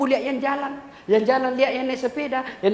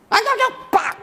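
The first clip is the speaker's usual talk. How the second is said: urging; 12 semitones higher, 3 dB louder than usual. Real, not acted. Urging the audience on.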